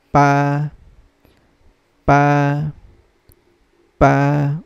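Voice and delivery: Pa held long and level; first tone. Shown as a demonstration. Teacher reading number eight, Pa in level first tone. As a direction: say Pa as a steady sustained note. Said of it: "Again, the first tone".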